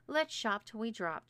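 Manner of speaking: ordinary delivery, nothing marked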